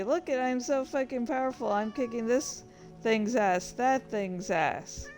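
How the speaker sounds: ordinary talk, nothing marked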